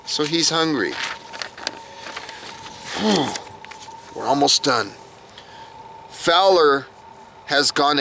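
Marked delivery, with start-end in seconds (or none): 2.0-3.4 a long soft tired sigh; weary sigh. Real, not acted. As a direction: let the breath out like it's all too much. Weary sigh after hungry contestant.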